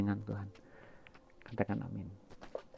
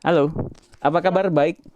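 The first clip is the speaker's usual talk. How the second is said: speaking up loud; 6 semitones higher, 4 dB louder than usual. Real, not acted. Speaking up over a broken phone connection.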